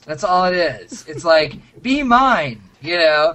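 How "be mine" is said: 1 candy-heart voice. Retold or imitated. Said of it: "like Valentine candies".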